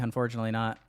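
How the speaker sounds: usual speaking voice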